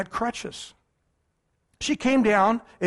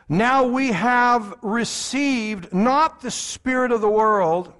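slow, louder, solemn Bible reading; scripture reading; 2 semitones higher, 4 dB louder, slower than usual. Acted. A preacher reading the verse aloud, slow and solemn. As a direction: declaim the verse to the congregation.

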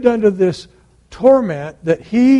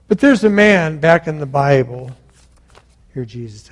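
ordinary talk

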